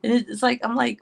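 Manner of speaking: normal talking voice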